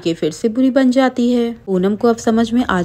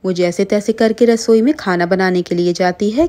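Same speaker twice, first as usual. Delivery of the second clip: plain reading voice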